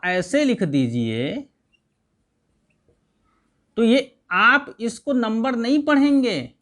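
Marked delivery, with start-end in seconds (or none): none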